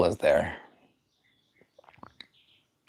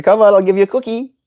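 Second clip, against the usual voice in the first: bribing; 9 semitones higher, faster than usual. Real, not acted. Bribing bird with cookies.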